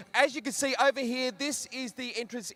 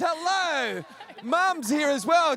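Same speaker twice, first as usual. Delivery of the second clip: excited greeting; 5 semitones higher, 4 dB louder, much slower than usual. Real, not acted. Host greets the runner's mum.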